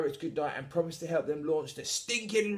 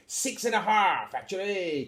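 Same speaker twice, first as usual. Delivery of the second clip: pirate captain; 6 semitones higher, 4 dB louder than usual. Acted. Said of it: "pirate voice".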